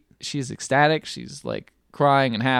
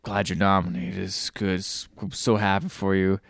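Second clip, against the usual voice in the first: put-on low drunk mumble; 6 semitones lower, 8 dB quieter than usual. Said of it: "and he says".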